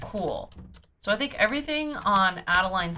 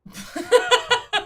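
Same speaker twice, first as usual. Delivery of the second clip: high, loud cackling laugh; loud burst of laughter; 8 dB louder than usual. Real, not acted. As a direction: crack up out loud.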